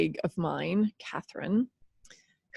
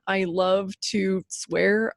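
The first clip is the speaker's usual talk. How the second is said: ordinary talk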